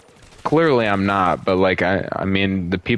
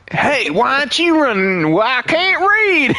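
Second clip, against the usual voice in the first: mock hillbilly. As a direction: play a clueless hillbilly. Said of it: "Barber Tucky".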